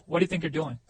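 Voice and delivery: connected, fluent question with YOU'RE contracted; everyday linked-up delivery; joined-up natural version. Shown as a demonstration. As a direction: repeat it in one smooth run. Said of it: "이렇게 써야 돼요".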